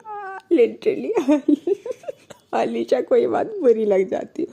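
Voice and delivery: high giggly laughter over the words; real laughter breaking through; laughing. Real, not acted. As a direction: narrate while still laughing. Narrator laughing again.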